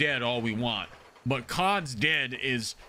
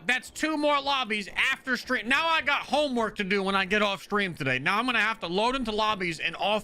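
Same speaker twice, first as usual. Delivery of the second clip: angry rant; 6 semitones higher than usual. Real, not acted. Streamer scolding the enemy player for messing up his day, angry rant. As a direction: scold the player for ruining your plans.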